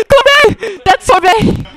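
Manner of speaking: loud gasp then stunned shout; horrified discovery; shocked gasp. Acted. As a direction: react with a shocked cry at the body. Finds the body.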